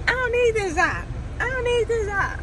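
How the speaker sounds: high, loud mock rant of a fed-up worker; imitating worker. Retelling restaurant worker quitting, hammed-up loud. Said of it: "She was like".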